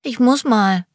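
put-on high piping little boy, urgent; small boy pleading he needs to go; squeaky child voice. A narrator's reading. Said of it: "mit piepsiger Stimme".

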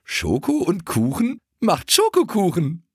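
pony giggling through a silly pun; giggling joke. Acted. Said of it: "Keks kicherte".

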